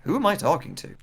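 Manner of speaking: softly acted game-character questions; understated, puzzled game line; Silent Hill style. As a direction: wonder it under your breath, as in Silent Hill. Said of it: "going under the idea of that Silent Hill".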